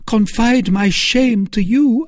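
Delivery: high, loud repeat of the plea; repeated shamed cry. A narrator's reading. Milady repeating her shamed cry to Felton, high and loud. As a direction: press the shame again.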